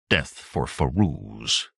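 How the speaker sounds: ordinary delivery, nothing marked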